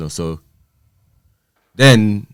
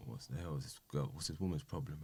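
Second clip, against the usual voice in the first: much softer, lower inner grumble; muttered thought; 4 semitones lower, 21 dB quieter than usual. Retold or imitated. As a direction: say it under your breath.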